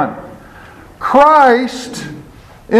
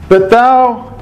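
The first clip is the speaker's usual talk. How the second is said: ordinary delivery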